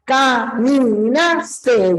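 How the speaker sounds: "Caminaste" drawn out slowly as ca-mi-nas-te, one clap per syllable; slow schoolroom counting of "Caminaste". Shown as a demonstration. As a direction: clap out "Caminaste" in four separate beats. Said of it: "a ti te enseñaban así".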